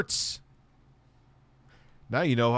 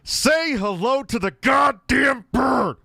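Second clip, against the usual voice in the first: much higher, loud and slow shout; high shrieking demand; 13 semitones higher, 6 dB louder, slower than usual. Pretend. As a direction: scream the order way up high.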